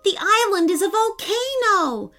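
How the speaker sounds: put-on high-pitched child's exclamation; excited shout. A narrator's reading. Boy realises island is erupting, high excited cry. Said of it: "shouted Austin".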